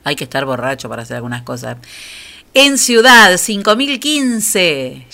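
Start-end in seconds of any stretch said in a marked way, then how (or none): 2.5-5.0 bingo caller. Real, not acted. Calling out lottery results.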